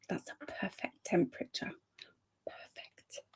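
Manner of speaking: breathy whispered delight at the chill. Real, not acted. Pleased about the cold room, hushed whisper. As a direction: whisper it like savouring cool air.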